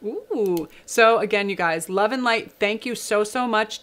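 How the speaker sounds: normal talking voice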